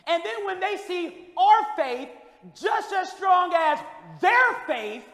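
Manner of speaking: high, loud preaching run; building pulpit preaching; preaching at full force. Acted. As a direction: drive the point home to the church. Preaching about faith.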